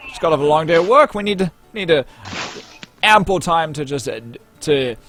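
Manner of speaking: loud, high boozy voice; gruff patron. Acted. Drunk patron explaining to the elf, loud and high. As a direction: lecture the newcomer on drinking.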